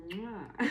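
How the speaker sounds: loud, high smacking air kiss; blown kiss. Real, not acted. Vlogger blowing a kiss to viewers, loud smacking kiss. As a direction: send a big kiss to the camera.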